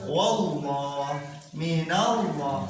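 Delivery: wallah dan minallah dibaca tebal; lafaz tebal. Shown as a demonstration. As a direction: bacakan wallah, minallah dengan lam tebal. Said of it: "Cara membacanya harus tebal ke lafad". (lafaz Allah dibaca tebal)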